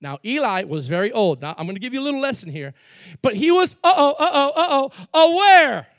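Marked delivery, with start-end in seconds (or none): 3.2-5.9 much louder, higher, rising alarm; dramatic uh-oh. Acted. Preacher teasing a revelation, rising alarm. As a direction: build the suspense up.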